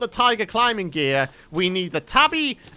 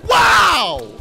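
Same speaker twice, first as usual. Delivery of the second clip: shocked shout; 10 semitones higher, 6 dB louder, much slower than usual. Real, not acted. Huge hit taken.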